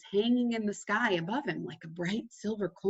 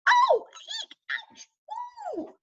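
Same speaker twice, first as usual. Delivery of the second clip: much higher squeals while tumbling; falling cries. A narrator's reading. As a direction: yelp all the way down. Mole slipping from tree.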